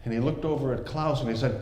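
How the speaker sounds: plain reading voice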